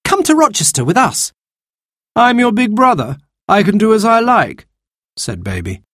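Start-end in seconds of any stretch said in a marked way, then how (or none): 0.0-1.3 put-on much higher girl's voice; girl's pleading. Anthea pleads. 2.1-4.7 much higher, defiant voice; a brat insisting he's in charge; cocky young man. Acted. Baby defies sister.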